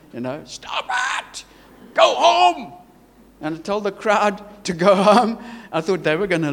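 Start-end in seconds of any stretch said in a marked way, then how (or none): 0.4-2.7 re-enacted drill-sergeant command, high and forceful; military tone. Preacher ordering brawlers and crowd apart, military shout. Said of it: "in my best military accent and, and, and tone".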